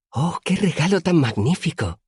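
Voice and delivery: delighted gasp. A narrator's reading. Auri receiving honey wine from her friend, delighted high voice. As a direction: marvel at the bottle.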